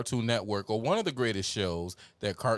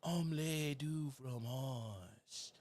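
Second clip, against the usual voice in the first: imitating Dexter; 3 semitones higher, 9 dB quieter, much slower than usual. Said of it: "just by saying".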